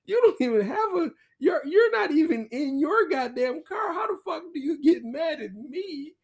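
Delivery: high, loud indignant woman's voice; imitating bestie. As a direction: squeal it back at him, outraged. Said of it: "from her point of view".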